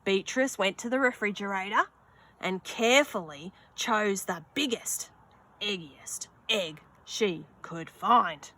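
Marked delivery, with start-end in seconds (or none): none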